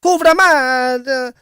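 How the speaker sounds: Polish curse in a slow, drawn-out Italian accent. Shown as a demonstration. Streamer reacting to a Polish chatter, in an Italian accent. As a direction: stretch out the Polish swear word like an Italian would. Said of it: "I said a Polish wo-, word with an Italian accent".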